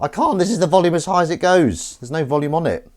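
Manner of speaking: exasperated. Real, not acted. Frustrated with the toy's volume, loud and high. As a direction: blurt it out, fed up.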